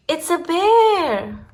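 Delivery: high, loud, slow alarmed cry; shocked cry. A narrator's reading. Reading to a child, crying out in alarm at the bear. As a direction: gasp it out in alarm.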